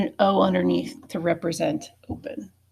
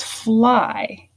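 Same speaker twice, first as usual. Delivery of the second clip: slow, stretched "fly"; drawn-out fly; 3 semitones higher than usual. Shown as a demonstration. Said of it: "add Y to this word".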